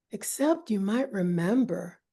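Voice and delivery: usual speaking voice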